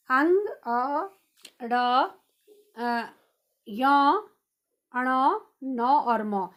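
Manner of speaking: अंग and अण, न, म slowly hummed through the nose; nasal sounds demo. Shown as a demonstration. Teacher demonstrates nasal sounds to children. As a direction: let the nasal letters ring slowly. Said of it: "आवाज़ नासिका से आ रही है".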